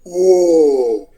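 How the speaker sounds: long falling crowd "whoa"; imitated crowd whoa. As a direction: echo a whole crowd's delayed roar in one drawn-out sound. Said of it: "all of a sudden I hear".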